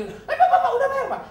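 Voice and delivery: very high, loud eager call. Retold or imitated. Preacher calling to his enemy at the restaurant, high loud call. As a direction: flag him down, laughing.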